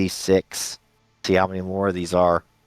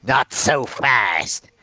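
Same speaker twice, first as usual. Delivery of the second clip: slow, drawn-out challenge from an ambusher; ambusher's challenge; 3 semitones higher, much slower than usual. Acted. Game master voices an ambusher stopping the handover, drawn-out challenge. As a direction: call it out slowly as an unseen voice halting them. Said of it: "you hear a voice say".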